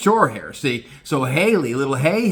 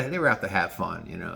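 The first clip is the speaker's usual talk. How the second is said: ordinary delivery, nothing marked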